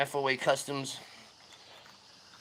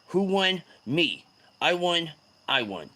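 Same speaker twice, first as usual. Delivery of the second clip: ordinary talk, nothing marked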